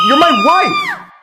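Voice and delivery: drawn-out, anguished yell; desperate shout. Acted. George bellows at Mary in despair. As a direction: shout it as if it could make her remember.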